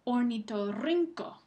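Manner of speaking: slow, loud "Ornitorrinco", punching RRIN; stress on RRIN. Shown as a demonstration. Teacher quizzing students, slow strong stress on RRIN in "Ornitorrinco". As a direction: make RRIN stand out in "Ornitorrinco". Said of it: "Rin es la sílaba que recibe".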